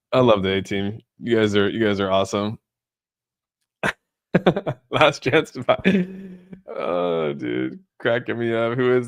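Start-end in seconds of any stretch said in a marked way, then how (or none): 4.3-4.8 quick high chuckle; laugh. Real, not acted. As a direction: chuckle at the joke. Streamer laughing at chat.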